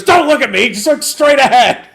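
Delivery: high, very loud drill-sergeant yell; sergeant's shouted order. Retold or imitated. Host playing the sergeant ordering a recruit, high-pitched shout.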